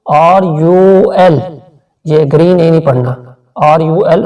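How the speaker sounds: ordinary talk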